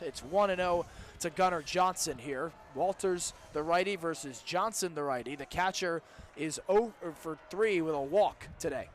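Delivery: usual speaking voice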